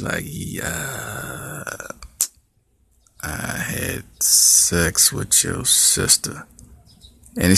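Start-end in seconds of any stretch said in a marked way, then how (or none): 0.3-6.5 put-on slow, hushed, sheepish boyfriend; soft slow sheepish admission; mumbled confession.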